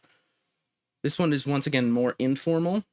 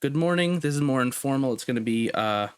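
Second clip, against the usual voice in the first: ordinary talk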